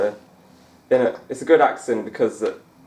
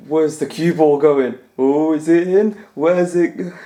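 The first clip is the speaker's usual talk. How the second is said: higher, louder, slow excited commentator voice; John Virgo impression; 5 semitones higher, 6 dB louder, slower than usual. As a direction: ham up a breathless snooker pundit wondering where the ball goes. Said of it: "How do you do a Virgo accent?".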